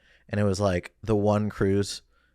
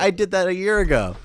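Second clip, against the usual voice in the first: indignant; 9 semitones higher, 7 dB louder than usual. Retold or imitated. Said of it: "I was like".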